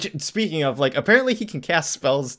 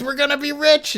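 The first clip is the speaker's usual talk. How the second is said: put-on much higher giddy kid voice; thrilled kid; 4 dB louder than usual. Fan voicing Freddy thrilled at the money, high giddy voice. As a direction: gush with delight. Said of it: "And he says".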